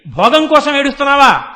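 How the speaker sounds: loud, indignant mock rebuke; retold angry scolding. Shiva rebuking Vyasa in Kashi, retold angry scolding. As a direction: scold him loudly, as retold. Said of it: "అని కోపడ్డాడు".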